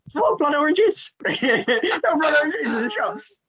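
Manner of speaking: high, laughing puzzled question; confused self. Retold or imitated. Asking the bartender, laughing in high puzzled disbelief. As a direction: squeak it out, baffled and giggling.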